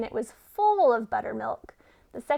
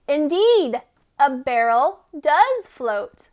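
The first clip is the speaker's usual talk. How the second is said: triumphant; 2 semitones higher, 7 dB louder, slower than usual. Acted. Goat triumphant.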